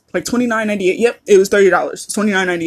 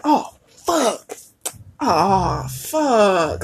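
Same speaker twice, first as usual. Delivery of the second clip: drawn-out oh fuck; 4 dB quieter, much slower than usual. Shown as a demonstration. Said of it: "different tones mean different".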